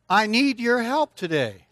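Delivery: loud, high appeal for help; calling out. Real, not acted. Preacher to kids in the audience, calling out. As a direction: call it out slowly, high and loud.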